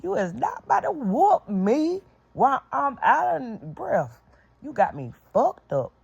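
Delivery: high, strained pleading-threat; heated warning. Retold or imitated. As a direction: tell her off while catching your breath. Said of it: "I'm steady telling her".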